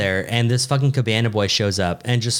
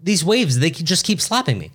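higher-pitched comic complaint; mock exasperation; 6 semitones higher than usual. Drunk swimmer griping about waves, mock-annoyed. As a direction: complain playfully about a petty nuisance, pitch up.

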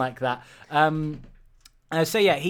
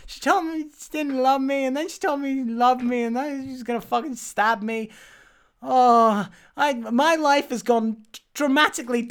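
high, hammed-up lament; mock self-pitying whine; 10 semitones higher, 4 dB louder than usual. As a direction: moan like a wronged, pampered prince. Acted prince's self-pitying complaint.

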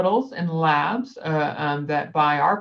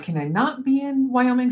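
higher, hopeful plea; pleading; 9 semitones higher than usual. Retold or imitated. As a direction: beg a little, pitch up. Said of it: "So I'm like".

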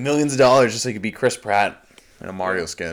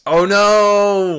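shocked cry; 11 semitones higher, 9 dB louder than usual. Real, not acted. Reacts to ghost news.

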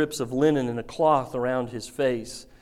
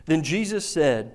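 ordinary talk, nothing marked

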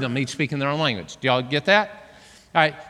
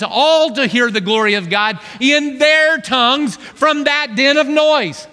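fiery preaching; 5 semitones higher, slower than usual. Acted. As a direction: preach it with rising fire. Preaching builds to full force.

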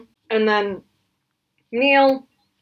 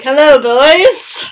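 put-on Australian accent; 5 semitones higher, 8 dB louder, much slower than usual. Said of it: "However you do an Australian accent".

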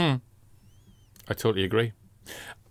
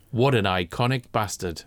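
normal talking voice

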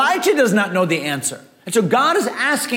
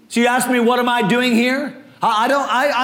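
ordinary delivery

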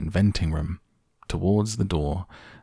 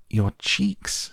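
usual speaking voice

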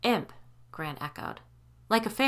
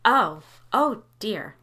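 ordinary delivery

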